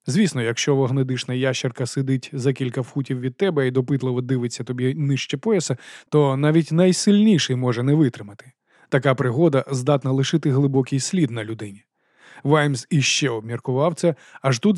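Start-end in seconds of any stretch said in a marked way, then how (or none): none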